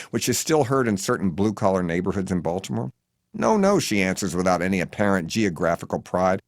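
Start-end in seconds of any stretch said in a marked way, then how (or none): 3.3-3.8 put-on higher, louder old lady. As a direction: chirp it like a dotty lady. Edith answering.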